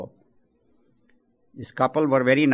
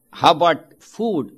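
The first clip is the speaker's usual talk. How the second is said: slow, louder question; 4 semitones higher, 7 dB louder than usual. Acted. Old man asks St. Peter.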